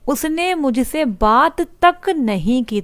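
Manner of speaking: slow, deliberate, weight on तक; stress on तक. Shown as a demonstration. Teacher repeating the example, slowly stressing तक. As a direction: press the word तक slowly. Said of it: "जोर दिया है इस particular शब्द पे".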